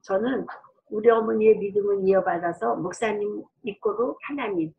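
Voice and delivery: ordinary delivery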